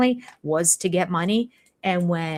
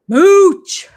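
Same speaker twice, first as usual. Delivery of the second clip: loud, high, scornful shout; yelled insult; 11 semitones higher, 13 dB louder than usual. Real, not acted. Scorning the freeloading wife to viewers, a loud jeering shout. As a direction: spit the word out with contempt.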